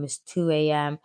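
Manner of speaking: usual speaking voice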